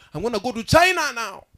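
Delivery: loud, high cry of resolve; acted declaration. Evangelist surrendering to God at altar, loud declaration. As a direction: call it out as a vow to God.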